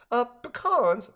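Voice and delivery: high, longing reply; dreamy. A narrator's reading. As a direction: choose as if lost in a daydream. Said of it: "replied dreamily".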